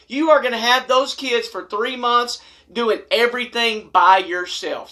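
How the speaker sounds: ordinary talk, nothing marked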